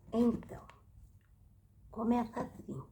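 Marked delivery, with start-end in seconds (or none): none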